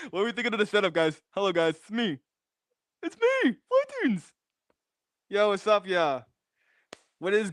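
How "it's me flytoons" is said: very high falsetto squeal; silly squeaky intro. Pretend. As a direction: announce yourself in a comic squeak. Silly repeat of his own introduction.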